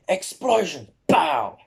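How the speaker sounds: high mock battle cry with blast noise; attack shout. Fan acting Bakugo's blast attack, high-pitched battle cry. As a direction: call out the attack, then imitate the bang.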